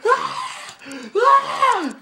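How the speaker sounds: loud high-pitched shriek; high squeal. Real, not acted. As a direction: let out a sharp squeal. Squealing after laughing fit.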